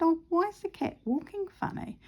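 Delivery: retold high-pitched puzzlement; puzzled question. Owner notices her cat walking oddly, puzzled. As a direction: wonder aloud, pitch lifted high.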